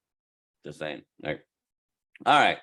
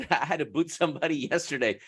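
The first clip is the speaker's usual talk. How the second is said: ordinary talk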